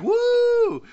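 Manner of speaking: very high, loud whooping cheer; crowd cheer. Retold or imitated. Imitating the crowd cheering the Joker, loud whoop.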